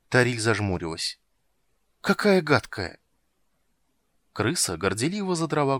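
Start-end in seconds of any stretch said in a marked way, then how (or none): 2.0-3.0 high, disdainful exclamation; disgusted. Acted. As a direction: recoil from something vile. Princess disgusted.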